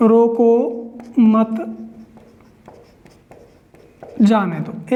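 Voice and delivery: slow dictation, low falling जाने दो; word-by-word dictation. Shown as a demonstration. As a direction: isolate each word with long pauses. Writing the same sentence again.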